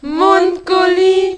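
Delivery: high, slow drawn-out product name; chipper 1950s ad. Acted. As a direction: stretch the brand name, high and loud.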